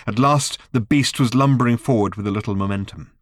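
plain reading voice

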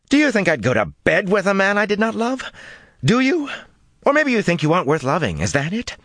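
jab each question at him; rising fury. A narrator's reading. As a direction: voice shooting up in anger. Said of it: "her voice began to rise".

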